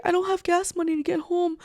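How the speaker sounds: high-pitched whiny plea; mock crying. Ex crying for gas money, high mocking imitation. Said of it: "'cause he's crying. He's like".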